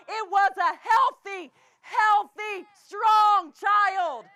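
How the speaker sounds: slow, loud, rising preacher cadence; full-force preaching. Acted. Preacher to the brethren, slow and loud. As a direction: hammer each line home from the pulpit.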